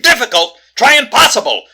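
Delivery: loud, high, scornful correction; exasperated disbelief. Acted. Detective correcting a believer in ghosts, exasperated. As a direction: throw the word back at full volume. Detective throws the word back.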